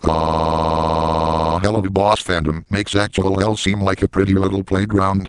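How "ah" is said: drawn-out fake moan; hammy held moan; mock moan.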